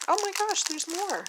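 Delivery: high, loud squeal of surprise; Oh my gosh squealed high and loud; delighted surprise. Real, not acted. Surprise finding more gifts.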